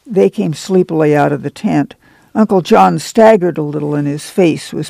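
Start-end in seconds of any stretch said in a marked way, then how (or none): none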